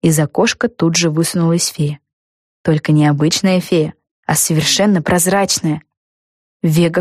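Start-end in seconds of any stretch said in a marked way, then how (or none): none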